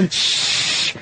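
a sharp hiss of shush to silence the car; loud shush. Retold or imitated. Comedian imitates Patrice shushing the subway crowd, sharp shush. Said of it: "and he'd just go".

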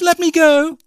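high, loud demand to be released; piercing storybook yell; shouted. A narrator's reading. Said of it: "As Cindy shouted".

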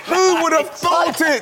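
gleeful shout. Real, not acted. Celebrating a right quiz answer.

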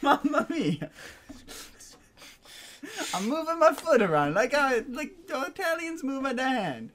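high, hammed-up mock Italian; Italian accent. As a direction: play it up as an excitable Italian. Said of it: "Why am I putting on an Italian accent".

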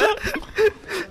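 high-pitched burst of laughter; laughing hard. Real, not acted. Listener laughs at impression.

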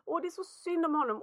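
usual speaking voice

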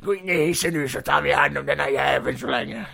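lightly voiced henchman; a hint of a villain's sidekick; corrected character voice. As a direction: give orders in a slightly put-on voice. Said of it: "svårt att hålla koll på alla".

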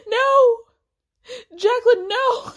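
loud, high-pitched hammed-up pleading; desperate cry. Onlooker begging her friend to stop, melodramatically desperate. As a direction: mock-desperate wail, pitch shooting up. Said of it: "desperately upset".

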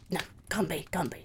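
whispering that it can't be her; whispered disbelief. Acted. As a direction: hush it as if doubting your eyes. Disbelieving muttered aside.

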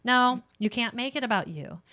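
ordinary talk

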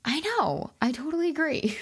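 playful high-pitched "I know"; gushing agreement. Retold or imitated. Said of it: "I'm like".

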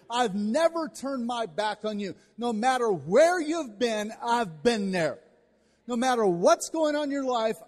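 loud, building declaration; impassioned sermon climax; preaching God's promise. Acted. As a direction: press each never home.

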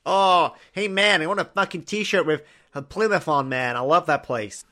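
mock fan. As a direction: ham up a clueless fan of Plymouth. Mock Plymouth shirt fan.

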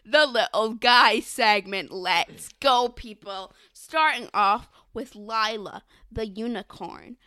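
loud, punchy show-host announcement; hyped segment announcer. Pretend. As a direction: kick off the big segment like an emcee. Hyped announcement of her own segment.

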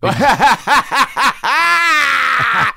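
loud burst of laughter. Real, not acted. Big laugh at the burn.

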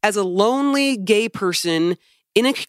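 high-pitched, emphatic venting; infuriated rant. Real, not acted. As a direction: hammer the grievance home in exasperation. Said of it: "'cause it's infuriating".

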